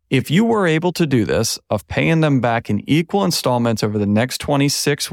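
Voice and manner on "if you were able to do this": "were" heavily emphasized, pitched up; strong stress on "were", underlining the if. Real, not acted. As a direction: repeat the condition, punching "were". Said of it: "were is very heavily emphasized there".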